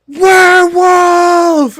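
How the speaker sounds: huge high slow roar; roaring shout. Acted. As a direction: bellow it out, drawn out. Werewolf bares teeth and goes.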